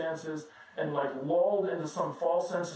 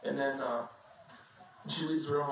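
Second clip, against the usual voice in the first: normal talking voice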